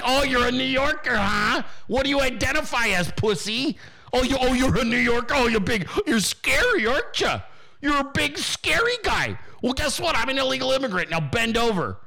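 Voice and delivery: high-pitched, sing-song mock taunt. Mocking bully taunting a New Yorker.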